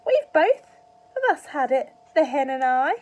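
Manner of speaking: put-on high, loud old woman's shout; shrill old-granny yell from the roof; Grandma shouting. A narrator's reading. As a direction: bellow it down from the rooftop like a cackling granny. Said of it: "Grandma shouted".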